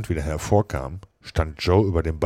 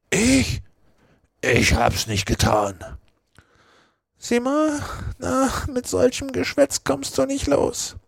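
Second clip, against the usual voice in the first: lightly voiced crook, raised and dismissive; cunning murderer pinning it on his friend; sly Joe. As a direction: brush him off, shifting the blame. Joe denies, blames Potter.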